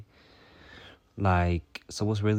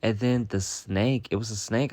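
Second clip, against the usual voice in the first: usual speaking voice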